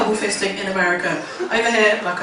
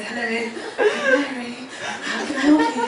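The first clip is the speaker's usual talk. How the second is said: softer, lightly put-on proper receptionist; proper work voice; 2 semitones higher, 4 dB quieter, faster than usual. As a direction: greet callers in a politely proper voice. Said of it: "sound a bit proper".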